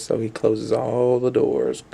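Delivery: ordinary delivery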